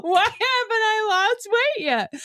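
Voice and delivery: high-pitched comic wail; mock dismay. Playing a dieter puzzled at the doctor's, high mock dismay.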